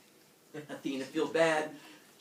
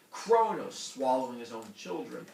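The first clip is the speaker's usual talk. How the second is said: ordinary talk, nothing marked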